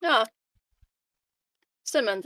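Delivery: usual speaking voice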